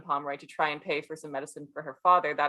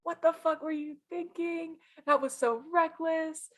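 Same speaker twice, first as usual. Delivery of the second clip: mock angry scold; 10 semitones higher than usual. [name] scolding Frederick, mock angry and higher. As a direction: tell him off in a put-on shrill. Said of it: "she gets mad at Frederick".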